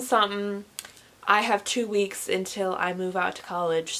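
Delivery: ordinary talk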